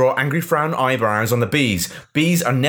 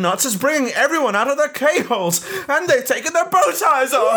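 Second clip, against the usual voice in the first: high shrieked reveal; shrieking; 12 semitones higher than usual. Acted. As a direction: shriek the last words. Climactic comic reveal.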